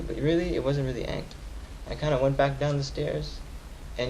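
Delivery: ordinary talk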